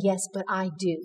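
a hushed, loving answer; gentle reassurance. Retold or imitated. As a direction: soothe her quietly as God would. God answers her tenderly.

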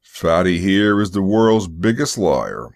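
big, booming accusation; booming driver. Acted. Driver turning on the accuser, booming voice. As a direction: boom it out at the accuser. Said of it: "It was a booming voice".

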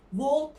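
"Walk" as Turkish "volk"; wrong walk, l sounded. Shown as a demonstration. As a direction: pronounce the l in "Walk", with a v start. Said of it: "Walk değil".

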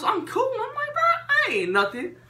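high, excited boasting; hammed-up tough-guy brag; hyped brag. Boasting kid retelling toughness.

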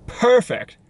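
"Perfect!" leaping up high then falling; sing-out little high cheer; high-pitched exclamation. Real, not acted. Repeats perfect in mock delight.

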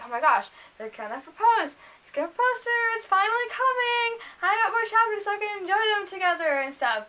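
high, giddy anticipation; thrilled, pitch jumping up after the gasped opener; excited. Retold or imitated. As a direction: build into a reenacted fangirl gush. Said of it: "I was, like, so excited".

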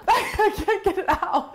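laughing. Real, not acted. Laughing while trying to burp.

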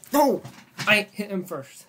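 high, loud angry protest; raging gamer. Real, not acted. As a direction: yell it out in outrage.

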